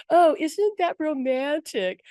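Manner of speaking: drawn-out, high mock swooning; teasing. Angels ribbing her friend over a romantic movie, teasing. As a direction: tease with slow, sing-song exaggeration. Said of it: "teasing her".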